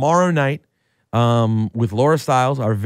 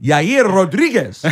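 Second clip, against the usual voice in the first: slow, rolled Mexican Spanish name; Mexican accent; 7 semitones higher, 4 dB louder, slower than usual. Shown as a demonstration. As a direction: say it like a Spanish speaker. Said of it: "let me get my Mexican accent".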